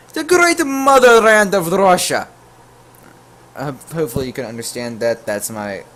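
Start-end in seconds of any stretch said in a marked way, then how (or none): 0.1-2.3 loud, high mock Russian accent; bad Russian accent. Telling a stranger online he's from Russia in a hammed-up Russian accent. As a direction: ham up a horrible Russian accent. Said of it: "That's my horrible excuse of a Russian imitation".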